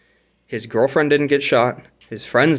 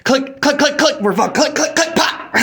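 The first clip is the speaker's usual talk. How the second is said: very high, loud staccato clicks; frantic high sound-effect voice ending in a pop; imitating misfiring gun; 13 semitones higher, 5 dB louder than usual. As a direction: act out repeated trigger pulls until it fires. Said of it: "pulled the trigger four times before it finally went off".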